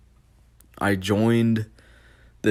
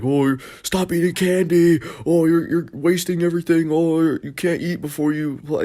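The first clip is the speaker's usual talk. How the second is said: much higher, whiny mock kid scolding; mad teammate; 8 semitones higher than usual. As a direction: imitate an annoying kid nagging. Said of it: "He was just getting all mad".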